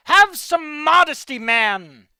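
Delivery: high, loud, slow outraged yell; hammed-up indignant cry; mock scolding shout. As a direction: scold him loudly for showing off. Scolding the king he's drawing.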